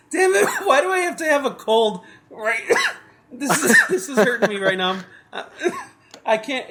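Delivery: painful laughter breaking through a cold; laughing through pain. Real, not acted. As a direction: laugh high and hurting, trying to stop. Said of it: "it hurts to laugh".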